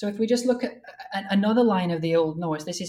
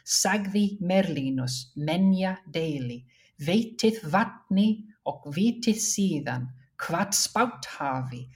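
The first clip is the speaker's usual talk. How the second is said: put-on slow, deliberate Old Norse recitation; Old Norse verse recital; slower than usual. Shown as a demonstration. Scholar reading a stanza to show alliteration, slow recital. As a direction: recite the stanza steadily, word by weighted word.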